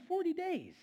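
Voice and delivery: high, disbelieving Forty days; incredulous. Real, not acted. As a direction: squeak it out in disbelief. Repeating the short time.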